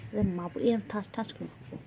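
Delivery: playful loud threat; mock threat. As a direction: pretend to threaten the pet. (মজার ছলে জোরে হুমকি)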